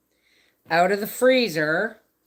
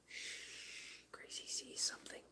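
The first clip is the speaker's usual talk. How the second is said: hushed whisper to stay unnoticed; 23 dB quieter than usual. Real, not acted. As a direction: whisper so the dog isn't startled. Dog notices something outside.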